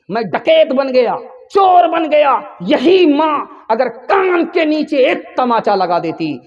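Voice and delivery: condemned man's ringing indictment; impassioned accusation. Acted. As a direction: plead your case loudly and high, building in outrage. Condemned son accusing his mother.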